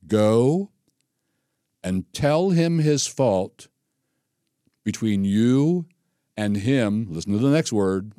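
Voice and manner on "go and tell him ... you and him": drawn-out words with long pauses between; slow, deliberate emphasis. Real, not acted. Preacher reading Jesus' instruction to the congregation, slow and emphatic. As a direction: spell out the command phrase by phrase.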